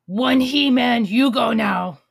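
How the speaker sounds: loud broken-English monster Santa; growling bugbear. Pretend. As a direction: bark like a hungry zombie. Zombie Santa shooing kid.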